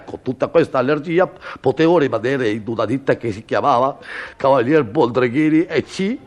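ordinary talk

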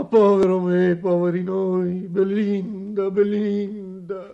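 high, loud cry of woe; wailing lament. Acted. Father bewailing his fate to Belinda, high wailing lament. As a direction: lament out loud, drawing out the name.

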